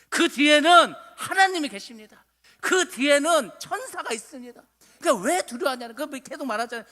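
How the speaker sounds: loud, high-pitched sermon delivery; preaching at full force. Real, not acted. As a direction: drive each declaration home to the congregation.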